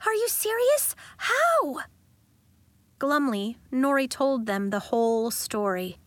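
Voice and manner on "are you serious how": disbelief. A narrator's reading. Pepper in disbelief.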